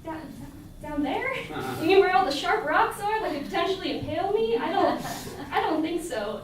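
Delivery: high, loud, incredulous refusal; nervous high-pitched no way; scared disbelief. Retold or imitated. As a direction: panicky protest at the cliff edge. Scared refusal at cliff edge.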